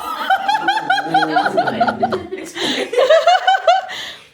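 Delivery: high, loud burst of laughter; real belly laugh. Real, not acted. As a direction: crack up loudly. Dog broke mom, big laugh.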